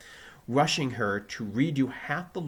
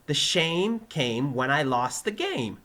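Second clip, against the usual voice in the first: slow, raised, measured delivery; careful recitation; 5 semitones higher, 6 dB louder, slower than usual. Acted. Pupil repeating for excited teacher, slow and careful. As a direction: repeat slowly in a lifted voice.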